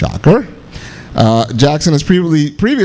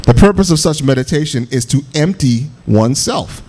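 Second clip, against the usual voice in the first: normal talking voice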